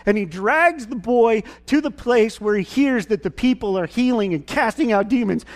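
ordinary talk

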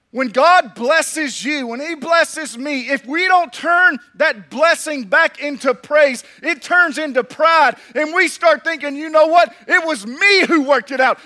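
loud, high preacher's push; fiery preaching. Acted. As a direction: drive the warning home to the congregation. Preaching at full force.

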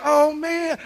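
high, loud wail of dismay; dismayed dad. Retold or imitated. Dad watches mom evict their son, high dismayed cry.

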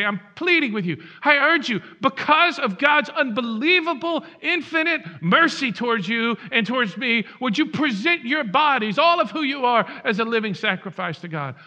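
loud, high, pleading sermon delivery; forceful preaching. Acted. Pastor urging the congregation, loud pleading preaching. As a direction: plead with the whole room at full force.

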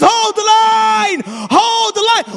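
high, loud battlefield rallying cry; impassioned roar, key words stretched out. Retold or imitated. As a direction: shout at full force to rally fleeing men. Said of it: "he's crying out".

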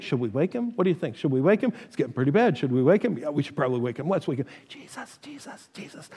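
soft, worried murmuring that fades to a whisper; timid disciples whispering in the boat; hushed nervous debate. Acted. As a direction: huddle and argue under your breath. Disciples whispering whether to wake Jesus.

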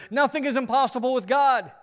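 preacher voicing the angel's promise to Mary, proclaimed loud and high; proclaiming promise. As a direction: announce "Nothing is impossible with God" like the angel's triumphant promise.

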